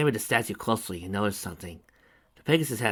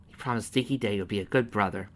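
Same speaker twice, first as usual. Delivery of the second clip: plain reading voice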